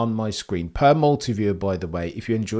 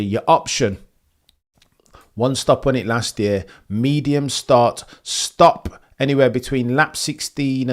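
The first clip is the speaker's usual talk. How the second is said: loud, raised-pitch broadcast delivery; race announcer rundown; 5 semitones higher, 7 dB louder than usual. Acted. Streamer giving viewers track facts before the race, loud announcer delivery. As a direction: punch out the stats like a commentator.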